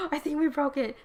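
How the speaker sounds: startled realization. Real, not acted. Reader realizes the age order broke, startled. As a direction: catch your breath in sudden surprise.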